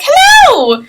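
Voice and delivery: very high, loud cheery greeting; a tiny ancient halfling delighted by visitors; old halfling shopkeeper calls out hello; little old halfling. Acted. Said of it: "he's like".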